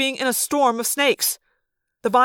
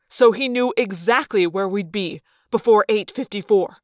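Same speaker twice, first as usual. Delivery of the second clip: ordinary delivery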